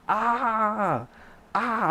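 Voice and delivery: mock scream of pain; pained cry. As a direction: cry from nail on bone. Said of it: "I'm like".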